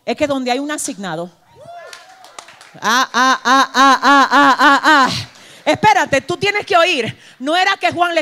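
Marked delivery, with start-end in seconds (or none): 2.8-5.1 big open laugh from the pulpit; hearty laughing fit; loud laughter. Real, not acted. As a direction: burst out laughing at the joke. Preacher bursts out laughing.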